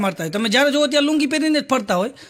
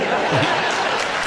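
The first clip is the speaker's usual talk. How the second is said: breathy laugh; 9 semitones lower than usual. Real, not acted. Laughing at pension punchline.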